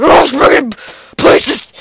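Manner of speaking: sudden loud yelp of a silly exclamation; random noise yell. Retold or imitated. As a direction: blurt it out loud for no reason. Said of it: "I make random noises".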